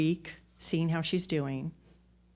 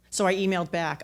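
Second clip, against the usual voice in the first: ordinary delivery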